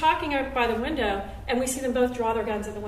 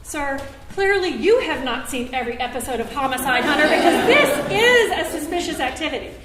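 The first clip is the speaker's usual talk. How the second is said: rising indignant retort, louder toward the end; mad; 2 semitones higher, 7 dB louder than usual. Retold or imitated. Woman on the phone scolds the officer, indignant. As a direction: scold the officer like he's clueless. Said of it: "now I'm just plain mad".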